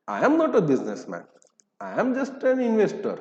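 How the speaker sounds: slow, high-swooping mock excuse; hammed-up slow denial with swooping pitch; mock protest. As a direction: imitate someone insisting on their innocence, drawn out. Mimics a trader excusing himself.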